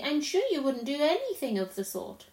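trusting, mildly shocked protest; disbelieving Lucy. Acted. Lucy refuses to believe the faun's confession, earnestly.

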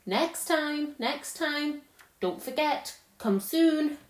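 a queen calling from a departing sledge. A narrator's reading. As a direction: call out to a boy as the sledge leaves. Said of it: "calling out".

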